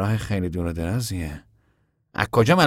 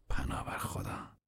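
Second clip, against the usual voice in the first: slow breathless whisper; 16 dB quieter than usual. A narrator's reading. Tom dismayed at the distance, slow whisper.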